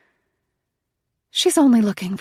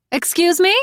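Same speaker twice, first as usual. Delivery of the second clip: high, loud call from a car; 12 semitones higher, 8 dB louder than usual. Acted. Woman in car calls to walker, loud high call. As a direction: call out over the rain to a stranger. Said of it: "Until a voice calls out".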